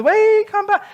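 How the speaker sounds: high, strained call after a bus. Acted. Man running after a missed bus, calling out.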